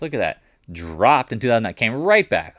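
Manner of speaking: carried away and emphatic; getting excited. Real, not acted. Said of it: "I'm just kinda getting excited".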